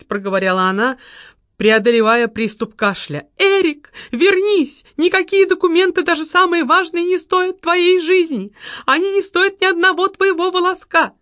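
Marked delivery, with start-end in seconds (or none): 3.4-11.1 мольба. A narrator's reading. Секретарша умоляет, кашляя.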